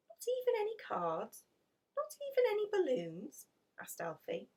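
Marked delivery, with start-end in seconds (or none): 0.1-3.5 put-on high, pleading child voice; a small boy who can't believe it; little boy's voice. Alfie asks Maureen about birthday presents, high child voice.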